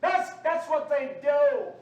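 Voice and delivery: slow, forceful rebuke; loud denunciation. Real, not acted. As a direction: drive the accusation home loudly. First take of rising denunciation.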